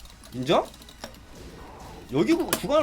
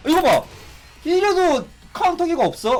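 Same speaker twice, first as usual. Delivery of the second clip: high, laughing triumphant taunt; laughing, excited taunt at the opponent; excited laughing gloat; 9 semitones higher, 3 dB louder than usual. Real, not acted. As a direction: crow over the hit with a laugh. Gloating after a counter hit.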